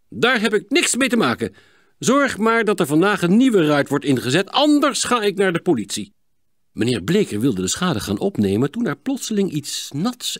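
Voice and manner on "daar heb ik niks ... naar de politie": loud, high, irate scolding; angry threat. Acted. Neighbour to boys about broken window, angry threat. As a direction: lay down the law angrily.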